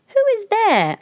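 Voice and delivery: put-on high old granny voice; old grandmother. A narrator's reading. Grandmother calls to the knock at her door, high and old. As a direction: call out like a frail old dame. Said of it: "asked the old dame".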